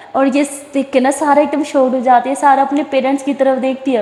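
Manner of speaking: usual speaking voice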